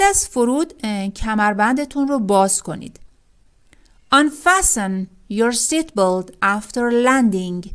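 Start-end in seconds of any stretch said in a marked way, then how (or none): 4.1-7.7 very slow, each word spaced for learners; slow, careful teacher reading; slow model sentence. Shown as a demonstration. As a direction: read it out slowly like a teacher. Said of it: "مثال".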